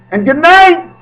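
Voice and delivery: high, loud sign-off call; a bright, excited farewell call; loud good night. Real, not acted. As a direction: shout the goodbye to everyone.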